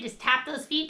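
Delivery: normal talking voice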